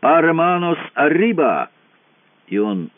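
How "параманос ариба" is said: higher-pitched narrated battle shout; thunderous cry. A narrator's reading. Katsuba ambushes the armed men on deck, thunderous cry. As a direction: call it out as a sudden, moderately voiced shout. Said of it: "крик Кацубы обрушился громом".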